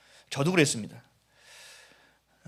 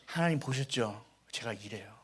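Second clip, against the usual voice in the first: sheepish confession; 7 semitones lower, 10 dB quieter than usual. Retold or imitated. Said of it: "하나님과 더 가까워졌습니다".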